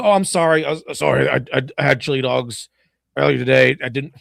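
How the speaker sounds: lightly voiced Batman, stammering an apology; a hint of an embarrassed hero, stumbling over words; hammed-up sheepish excuse; mock flustered Batman. Imagined Batman embarrassed after farting.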